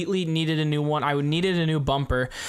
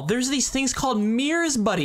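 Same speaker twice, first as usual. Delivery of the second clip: mocking, much higher sarcastic lilt; 9 semitones higher than usual. Real, not acted. Co-host mocks the driver who backed up, sarcastic. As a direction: tease him about his mirrors.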